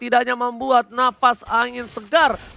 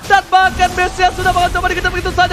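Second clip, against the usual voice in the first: loud, higher shouted hype call; fighting game announcer; 8 semitones higher, 6 dB louder than usual. Real, not acted. Hype call of base push.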